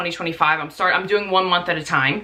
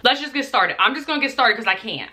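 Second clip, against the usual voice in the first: ordinary talk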